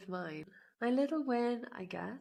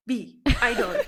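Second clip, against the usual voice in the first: mock eager pupil blurting, higher and much louder; eager student; 5 semitones higher, 12 dB louder than usual. Imitating herself answering the Dutch teacher, mock eager. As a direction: play the overeager teacher's pet, laughing.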